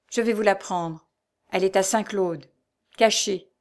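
ordinary delivery

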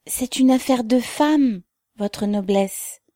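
high, slow, timorous reply; fearful excuse. A narrator's reading. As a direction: excuse yourself anxiously to the officer, voice lifted. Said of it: "murmura Korsunova d'une voix craintive".

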